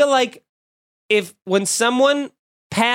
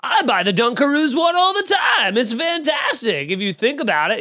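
mock commenter; 6 semitones higher than usual. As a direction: shout it like an overexcited commenter. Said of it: "that's gonna comment like".

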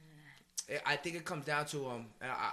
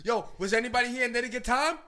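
louder, higher shout to the crowd; raised-voice retold crowd greeting; stage call-out; 6 semitones higher, 6 dB louder than usual. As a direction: imitate yourself hyping an audience. Retold calling to crowd onstage.

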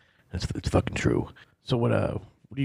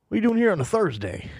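high, loud startled pastor with a cigarette in his mouth; pastor caught off guard; 13 semitones higher, 3 dB louder than usual. Acted. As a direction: bark it at the stranger who walked in. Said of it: "and he's like".

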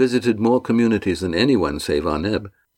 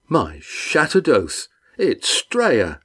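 slow, higher astonished exclamation; surprised rough voice; 6 semitones higher, much slower than usual. Acted. Red Ian recognising Estrella from his boat, surprised. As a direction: call out in glad surprise. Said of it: "exclaimed Estrella".

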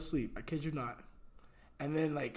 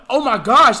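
panicked exclamation; 5 semitones higher, 15 dB louder than usual. Retold or imitated. Panicked retelling.

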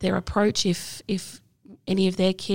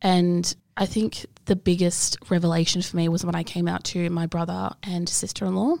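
ordinary talk

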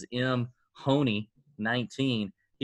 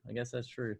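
I guess that's true said much softer, like an afterthought to yourself; softer repeat; 10 dB quieter than usual. Real, not acted. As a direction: drop the repeat to a muttered concession.

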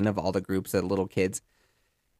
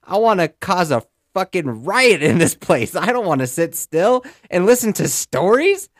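put-on much higher rebellious little kid; toddler voice; 8 semitones higher, 6 dB louder than usual. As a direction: rage like a toddler who won't sit still. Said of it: "Baby Yeti was like".

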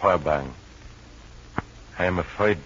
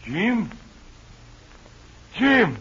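rising frantic calls for Jim; 1 calling out; 12 semitones higher, 3 dB louder, much slower than usual. Acted. Feverish man calls out for his friend, building to a shout. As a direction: shout his name higher and louder each time.

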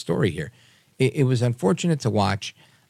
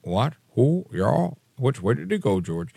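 put-on confused old man; 3 semitones higher than usual. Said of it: "now they're just like".

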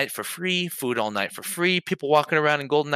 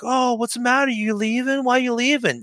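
high-pitched mock taunt; whiny, singsong mimicry of hecklers; 12 semitones higher than usual. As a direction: jeer in a silly high voice. Mimics taunting guys in the back.

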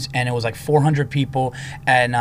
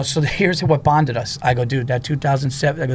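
ordinary talk

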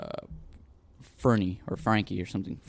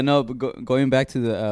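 ordinary talk, nothing marked

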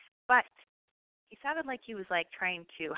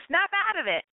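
high, sharp pretend slap-in-the-face order; mock wake-up call; 11 semitones higher, 4 dB louder than usual. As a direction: snap a friend out of it, playfully. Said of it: "slap me across the face and go".

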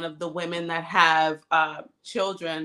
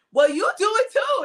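high-pitched, louder mock comeback; defensive deflecting; 5 dB louder than usual. Retelling herself dodging accountability with her partner, mock defensive. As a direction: act out a defensive tit-for-tat. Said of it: "likes to deflect".

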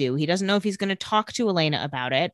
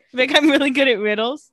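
higher, louder playful boast; goofy goblin boast; 7 semitones higher, 6 dB louder than usual. Pretend. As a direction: brag like a silly goblin.

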